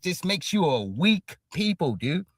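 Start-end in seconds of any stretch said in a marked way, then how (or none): none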